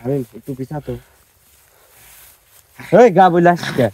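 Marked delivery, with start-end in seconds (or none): 3.0-3.9 loud angry yell; angry shout. Real, not acted. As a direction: shout at him furiously.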